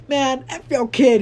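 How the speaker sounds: high, loud, slow furious retort; angry outburst. Retold or imitated. Snapping back at the scolding mom, a loud furious retort. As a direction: let the insult fly. Retold heated argument, cussing.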